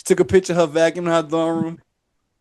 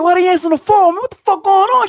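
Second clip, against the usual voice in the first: high, heated demand; angry demand; 13 semitones higher than usual. Retold or imitated. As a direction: confront her down the phone. Retold phone confrontation.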